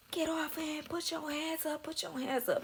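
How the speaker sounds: high-pitched imitation of officers' commands; retold police order. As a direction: fire off repeated orders, pitched high without shouting.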